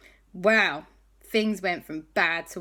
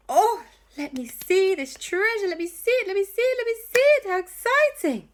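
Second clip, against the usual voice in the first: high, building, ever more eager pleading; rising giddy excitement, each repeat higher; 13 semitones higher, 5 dB louder than usual. Acted. Hattie begging to see the treasure, giddy and high.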